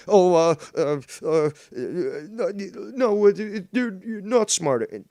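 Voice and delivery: high, flustered mock teacher. Teacher flustered by the gifted pupil, high mock voice.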